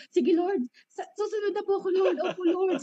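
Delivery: high, fast pleading prayer to God; tearful prayer. Retold or imitated. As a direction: plead with the Lord, voice lifted. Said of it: "Sabi kong ganyan".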